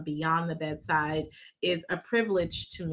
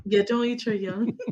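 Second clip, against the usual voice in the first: ordinary talk, nothing marked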